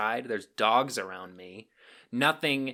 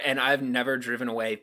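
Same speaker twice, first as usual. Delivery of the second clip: normal talking voice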